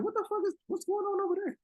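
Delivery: high, mock baffled cry; baffled disbelief. Retelling to the podcast host how he reacted to the page, high baffled disbelief. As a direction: pitch it way up like you can't believe it. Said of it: "I'm like".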